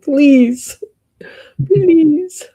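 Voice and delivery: high, loud, drawn-out plea; playful begging. Retold or imitated. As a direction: beg with a mock-desperate whine. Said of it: "So I begged Angel".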